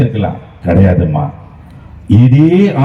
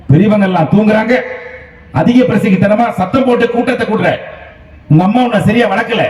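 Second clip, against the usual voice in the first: higher-pitched mock telling-off; retold uncle's scolding; 7 semitones higher than usual. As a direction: replay the uncle's scolding, a touch angry. (மாமாவின் திட்டலைப் போல லேசான கோபக் குரல்)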